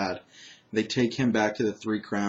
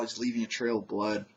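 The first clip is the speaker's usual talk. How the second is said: usual speaking voice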